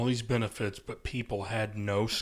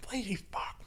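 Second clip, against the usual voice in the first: much softer, higher muttered curse; muttered insult; 10 semitones higher, 11 dB quieter than usual. Retold or imitated. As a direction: mutter it under your breath at a coworker.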